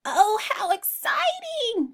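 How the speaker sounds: high sheep squeal of delight; excited sheep cry. Acted. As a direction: gush like a thrilled lamb. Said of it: "cried the sheep".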